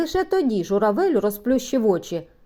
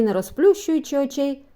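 usual speaking voice

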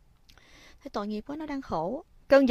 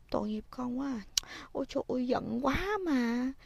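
sympathetic sighing; 3 semitones higher, 7 dB quieter than usual. Shown as a demonstration. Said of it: "dạy cho nó cách nói ra tình cảm".

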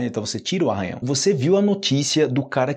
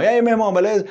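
high, lively slang greeting; upbeat, colloquial hello; hailing a buddy; 8 semitones higher, 4 dB louder, much faster than usual. Acted. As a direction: call out to your bro, pitched way up. Demonstrates a slangy street greeting.

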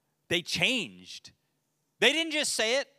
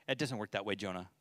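lower, softer, patient rebuke; gentle correction; 6 semitones lower, 5 dB quieter, faster than usual. Retold or imitated. God gently correcting Jonah, low and soft. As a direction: settle him down like a calm father. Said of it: "God's like".

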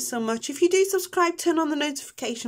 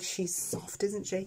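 a quick whispered aside, imitating viewers; mock whispered remark; imitating viewers' whisper; 3 semitones lower, 8 dB quieter, slower than usual. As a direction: hush it like a teasing onlooker. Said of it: "For those of you new think".

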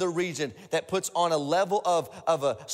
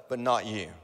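the devil's lie; 7 semitones lower than usual. Retold or imitated. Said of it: "he's gonna say".

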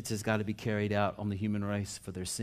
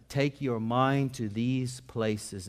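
drawn out at half speed; measured, unhurried emphasis on every word; slow, deliberate repeat; 3 semitones higher, 3 dB louder, much slower than usual. Real, not acted. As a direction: let each word of the line sink in. Quotation repeated slowly for emphasis.

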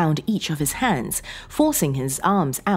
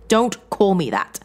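loud, higher, drawn-out sharp rebuke; snapped; 6 semitones higher, 6 dB louder than usual. A narrator's reading. John rejecting a pet name from Sarah, snapping. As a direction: cut her off angrily. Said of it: "John snapped".

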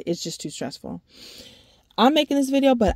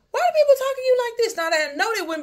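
high, loud, fast put-on snippy boss; mock boss voice; 5 dB louder, faster than usual. Complaining about work, mimicking a rude superior.

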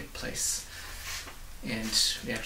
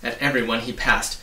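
ordinary delivery, nothing marked

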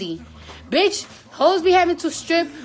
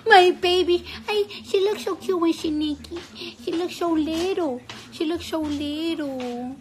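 tender adoring; 4 semitones higher than usual. Real, not acted. Adoring naked baby.

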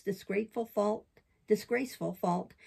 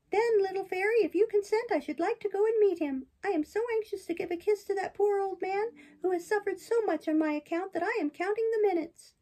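high, bright-eyed puppet, much higher than the narrator; puppet boy voice; 12 semitones higher, 3 dB louder than usual. Acted. Pinocchio protests to the fairy in a high boyish voice. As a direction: play Pinocchio as an eager young boy defending himself.

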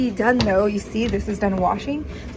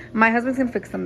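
ordinary talk

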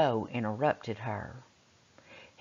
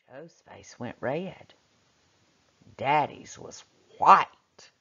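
normal talking voice

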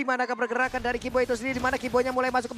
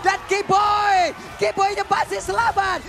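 much higher, louder, slowly stretched; excited shout; 6 semitones higher, 5 dB louder, slower than usual. Real, not acted. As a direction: yell it in disbelief. Caster shouting a narrow escape.

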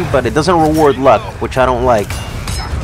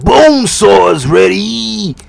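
drawn-out, high, loud wrestler yell; Bonesaw; 7 semitones higher, 5 dB louder, slower than usual. Acted. Gamer imitating Macho Man after losing, drawn-out wrestler yell. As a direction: bellow it like a hyped-up wrestler, words stretched out. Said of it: "You fucked up the Macho Man Randy Savage".

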